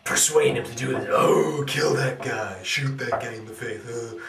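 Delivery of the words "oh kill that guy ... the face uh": lightly mocking imitation of an inner voice; a hint of a goading voice in the head; little guy in head. Little guy persuading Harvey Dent to kill, lightly mocked voice. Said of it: "there's this little guy in Harvey Dent's head".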